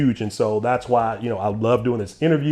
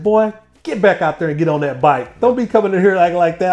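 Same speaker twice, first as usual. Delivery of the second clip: higher, louder, fast stern father; stern dad; 6 semitones higher, 5 dB louder than usual. Retold or imitated. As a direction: order the boy back onto the bike. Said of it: "He said".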